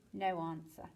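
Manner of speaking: ordinary delivery, nothing marked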